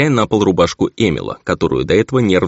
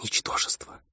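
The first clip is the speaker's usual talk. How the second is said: soft, voiceless whisper of contempt; 13 dB quieter than usual. A narrator's reading. Struck baroness on the bed, contemptuous whisper. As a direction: breathe the insult out in a whisper. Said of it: "упала на постель и прошептала".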